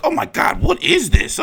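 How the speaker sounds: high, breathy mock outrage; a squealing, hammed-up shocked fan; mock shocked viewer. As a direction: gasp like a scandalised fan. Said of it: "some people that are gonna be like".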